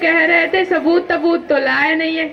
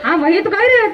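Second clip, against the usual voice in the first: usual speaking voice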